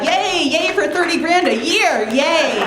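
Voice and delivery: high, mock-cheerful yay; mock celebration, high and bright; sarcastic yay. Sarcastic cheer about teacher pay.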